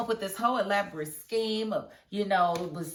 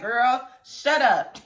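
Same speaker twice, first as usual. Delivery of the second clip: sassy retort; 5 semitones higher, 7 dB louder, much slower than usual. Retold or imitated. Her retort to Victoria onscreen.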